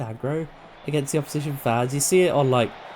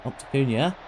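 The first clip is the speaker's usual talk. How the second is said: ordinary talk, nothing marked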